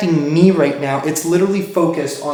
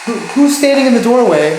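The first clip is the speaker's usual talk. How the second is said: loud high call at the doorway; 7 semitones higher, 8 dB louder than usual. Real, not acted. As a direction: call out to someone unseen. Calling out to presence in doorway.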